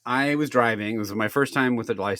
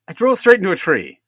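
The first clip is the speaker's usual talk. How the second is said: higher, louder retold blunt reply; blunt admission; 9 semitones higher, 6 dB louder than usual. Driver to cop after crashing into a tree, blunt. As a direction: state it bluntly, with annoyed emphasis. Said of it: "I was, like, really direct about it".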